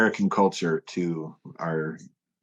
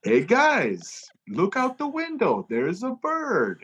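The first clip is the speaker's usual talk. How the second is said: much higher, stretched out bait; desperate fake question; 12 semitones higher, slower than usual. Tour manager desperately baiting the band, high and drawn out. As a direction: push the lie a little too hard. [name] trying his own trick.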